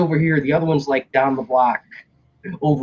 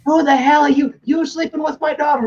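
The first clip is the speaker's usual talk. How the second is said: angry mother; 12 semitones higher than usual. Retold or imitated. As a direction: yell at the intruder, pitched up. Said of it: "she comes out of the house".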